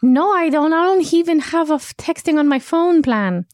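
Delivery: high tiny-creature voice; squeaky little character voice. Pretend. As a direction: play a small eager salesman. Ramplu denying the texting.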